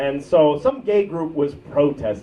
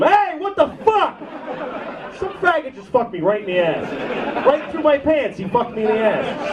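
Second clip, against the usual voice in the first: outraged ATM victim; 7 semitones higher than usual. Retold or imitated. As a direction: squeal in outrage at the bank. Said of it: "you're at the A T M".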